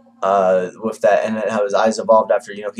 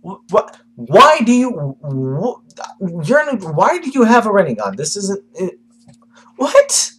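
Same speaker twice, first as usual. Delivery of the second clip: high, loud stunned yelling in a put-on voice; shocked outburst; 9 semitones higher, 5 dB louder, slower than usual. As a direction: sputter in disbelief, voice cracking up high. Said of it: "Naruto's like".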